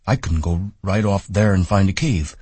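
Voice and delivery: sulky simple giant fretting; Lennie, childlike man. A narrator's reading. Lennie voiced in story.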